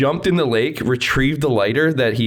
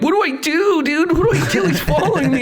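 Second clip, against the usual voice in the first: mock panic. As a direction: squeak it like you're terrified. Panicking to his buddy in truck.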